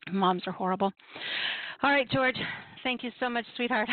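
normal talking voice

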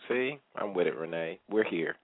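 ordinary delivery

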